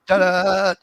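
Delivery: loud, high showy ta-da; triumphant ta-da. Retold or imitated. Rolling onto his wife in bed, a showy ta-da. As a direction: announce it like a magic trick. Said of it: "And I'm like".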